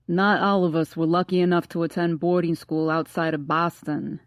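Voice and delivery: bad Boston accent. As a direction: overdo the Boston accent badly. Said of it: "in the worst Boston accent".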